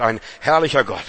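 usual speaking voice

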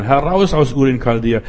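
ordinary talk, nothing marked

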